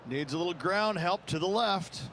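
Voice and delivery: normal talking voice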